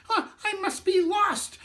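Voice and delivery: very high, scratchy squeak; tiny cracker man squeaking; squeaky matzah man. Acted. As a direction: squeak it out like chalk on a slate. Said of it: "in a voice that sounded like chalk on a slate, squeaked".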